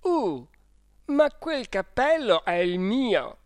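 high, slow, anguished shout; anguished cry. A narrator's reading. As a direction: cry out in alarm over the hat. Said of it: "gridando con angoscia".

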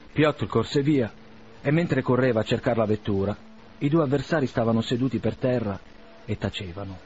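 plain reading voice

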